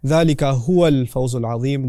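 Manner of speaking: ذَلِكَ هُوَ الْفَوْزُ الْعَظِيمُ with the added هُوَ given weight; recitation with huwa. Shown as a demonstration. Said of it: "There's an additional huwa".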